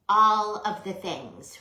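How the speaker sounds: slow emphatic ALL of the things; stress on all. Real, not acted. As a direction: lean into "All" slowly. Emphatic craft catchphrase.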